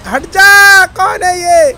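loud, high-pitched yell; outraged shout. Real, not acted. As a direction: yell at whoever is in the way. Yelling at whoever rams him.

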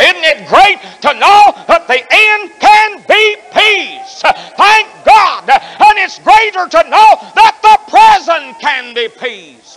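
high, slow, loud ecstatic preaching; fervent shouting preacher; preaching at full force. Real, not acted. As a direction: cry it out to the congregation.

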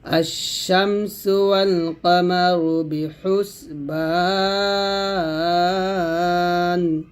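slow chant, holding "বা" of "বিহুসবান" very long; long madd. Shown as a demonstration. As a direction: stretch the last syllable of "বিহুসবান" for three alifs. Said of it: "বাতে তিন আলিফ টান দিয়ে".

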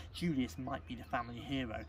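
ordinary delivery

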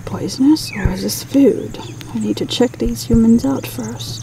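whispered bird inner voice; voicing the magpie. Vlogger voicing a cautious magpie in a whisper. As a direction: hush it like a wary magpie thinking. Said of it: "he's just like".

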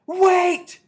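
piercing high shriek. A narrator's reading. Witch in the back row stopping everyone, shrieking. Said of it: "shrieked one of the witches".

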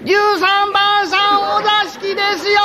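high, slow sing-song announcing; house announcement. Acted. Attendant announcing that room thirteen wants the hanger-on, high sing-song call.

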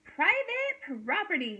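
high, drawn-out storybook yell. A narrator's reading. Hank warning off a tortoise, yelling. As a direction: yell a warning, stretching the words. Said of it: "yelled Hank".

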